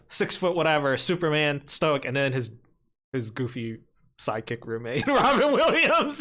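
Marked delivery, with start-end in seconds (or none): 5.1-6.2 laughing high and loud through the name; can't get the name out without laughing. Real, not acted. Friend laughing while telling the Juilliard roommate story.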